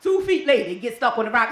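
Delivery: ordinary talk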